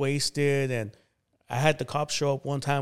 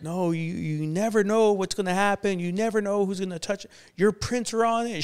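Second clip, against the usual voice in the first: much higher, alarmed and urgent; imitating his girlfriend's alarm, pitched up; freaking out; 7 semitones higher than usual. Girlfriend panicking over the gun in his hand, high and alarmed. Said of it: "like, freaking out".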